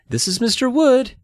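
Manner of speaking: put-on high girl's voice; Chris introducing. A narrator's reading. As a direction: present the dummy brightly. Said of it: "she told the Millers".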